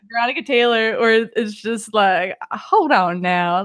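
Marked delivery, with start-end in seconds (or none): 2.5-3.6 lightly voiced Southern cowboy; slow, folksy Southern drawl. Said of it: "the cowboy voice".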